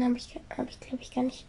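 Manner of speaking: unvoiced whisper; whispered mumble. Real, not acted. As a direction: mumble it under your breath. Unsure mumble.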